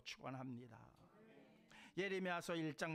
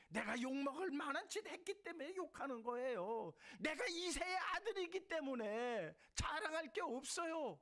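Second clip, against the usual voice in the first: high, loud, ringing sermon cry; full-force preaching; 14 semitones higher, 4 dB louder than usual. Acted. Pastor to the congregation, raising the voice in fervent preaching. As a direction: preach it out to the whole hall.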